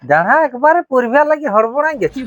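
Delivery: loud, high-pitched complaint; exasperated exclamation. Acted. Exclaims at partner's impatience. (চড়া গলায় বিরক্তি প্রকাশ)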